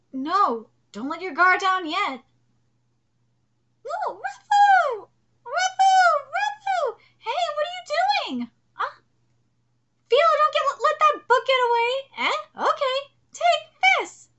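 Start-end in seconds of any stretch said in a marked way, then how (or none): 3.9-7.0 high pleading cry of a name; mock anguish, high voice; anguished girl's cry. Reading manga character cries. 7.2-14.3 higher, earnest character voice; lightly voiced anime girl; voiced manga heroine.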